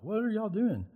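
high, puzzled question; young David baffled by the scared army. Retold or imitated. David finding the army scared, high puzzled question. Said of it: "And he's like".

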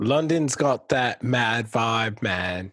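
put-on slow, drawn-out London accent. Co-host hyping London to the guest, London accent. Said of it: "really good London accent".

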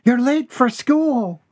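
very high, slow mother's reproach; a mother reminding sternly; very high slow mother's scold. A narrator's reading. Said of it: "mother scolds son".

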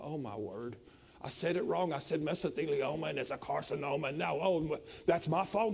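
low, quiet, mock fretful groan; mock dismay. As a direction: play the worried believer, muttering low. Mocking a worried believer.

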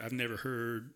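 ordinary talk, nothing marked

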